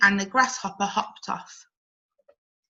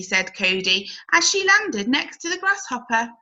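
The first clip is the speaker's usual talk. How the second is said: ordinary delivery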